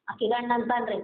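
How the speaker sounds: normal talking voice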